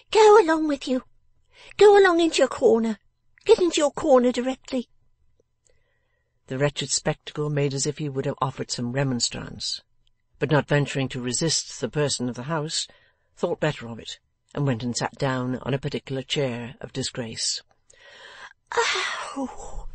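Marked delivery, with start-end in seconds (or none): 0.1-4.9 high, loud scolding command; sharp asperity. A narrator's reading. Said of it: "replied with irresponsive asperity". 18.7-19.9 high, airy exclamation of outrage; scolding cry of "Oh!". A narrator's reading. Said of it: "cried the person of the house".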